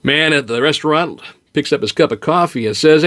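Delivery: ordinary delivery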